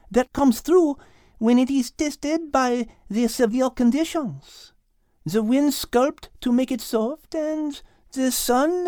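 musing aloud to her friend; French cow Liz, pensive. Acted.

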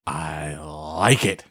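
sultry voice. Acted. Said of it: "this sultry thing".

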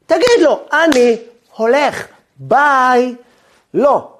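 loud, high, emphatic retort; indignant challenge. Acted. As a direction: punch out each word indignantly. Indignant objection to Moses' tactic.

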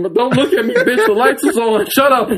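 high, laughing embarrassed snap; laughing mock snap. Wiping tears in front of the waitress, laughing. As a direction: shoo her away, giggling high. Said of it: "started wiping".

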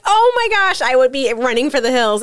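high, hammed-up fright; mock alarm. Host retells hearing the ghost video, mock alarm. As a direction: retell a startled reaction, starting high. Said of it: "and I'm like".

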